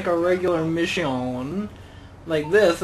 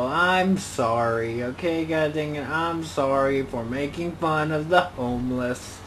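normal talking voice

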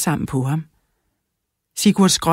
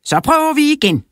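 much higher and louder, upbeat; eager teacher; 11 semitones higher, 5 dB louder than usual. A narrator's reading. Teacher Karsten cheering the class on, much higher. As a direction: cheerfully push everyone back to work. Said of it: "sagde Karsten".

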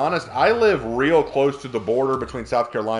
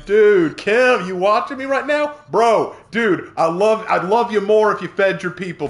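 loud, high-pitched mock appeal; playful calling out; 7 semitones higher, 6 dB louder than usual. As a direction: call out to a famous fan, hammed-up. Playfully calling out to Kim Jong-un.

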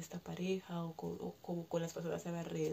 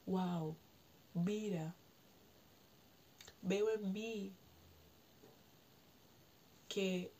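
gentle discovery; slower than usual. Shown as a demonstration. Showing listeners the healthier inner voice, calm and unhurried. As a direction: let each word land as a calm realization. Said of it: "No, se trata de".

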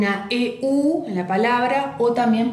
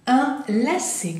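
"Un lacet" drawn out, raised pitch; slow French model; 4 semitones higher, much slower than usual. Shown as a demonstration. Teacher introducing a new word, slow and raised. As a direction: say "Un lacet" slowly and high.

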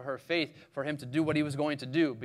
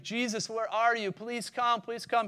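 higher-pitched pleading; pleading prayer; 6 semitones higher than usual. Acted. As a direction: plead for him to come. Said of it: "maybe even praying".

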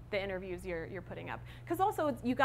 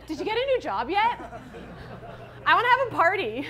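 put-on higher, louder eager little kid, laughing at the end; imitating four-year-old; 6 semitones higher, 6 dB louder, faster than usual. Mother mimics her four-year-old asking about the job, eager. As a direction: play the impatient preschooler begging for the party. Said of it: "my four-year-old asked me".

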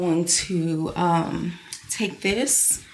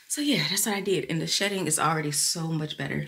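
ordinary talk

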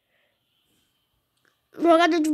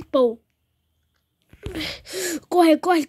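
ordinary talk, nothing marked

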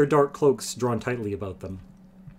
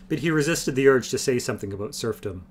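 plain reading voice

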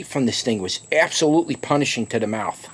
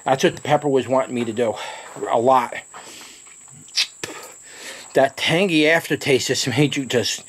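usual speaking voice

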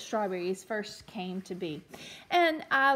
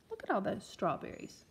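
usual speaking voice

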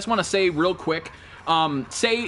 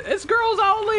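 much higher mimicking voice; mocking quote. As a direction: mimic a friend in a squeaky high pitch. Quotes friend in put-on high voice.

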